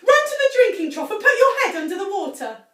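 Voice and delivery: high, loud frantic pleading; panicked plea. A narrator's reading. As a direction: beg her in a rush. Mrs Cranky panics for Grandma.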